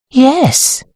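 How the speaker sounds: high, pensive agreement; musing, high-voiced yes; thoughtful. A narrator's reading. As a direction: agree as if thinking it over, pitched high. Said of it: "said Sybil thoughtfully".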